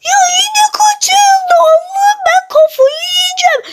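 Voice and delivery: high whining wail; hammed-up high-pitched distress; mock despair. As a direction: whimper it out in disbelief, way up high. Cow stolen again, despair.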